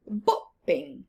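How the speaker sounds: usual speaking voice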